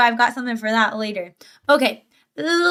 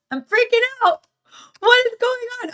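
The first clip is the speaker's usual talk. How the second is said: much higher, panicked squeal; freaking out; 10 semitones higher than usual. Real, not acted. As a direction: panic in a high-pitched yelp over the broken emotes.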